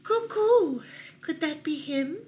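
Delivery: high, loud cooing call; cooing dove. Acted. Mourning dove asking about the stranger, high cooing. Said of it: "asked the mourning dove".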